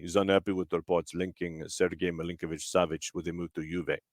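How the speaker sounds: Maurizio Sarri. Acted. Host slips into Lazio boss's voice reading transfer gossip, quick impression. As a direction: drop low and soft for a quick impression. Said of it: "a very quick, uh, Maurizio Sarri impression".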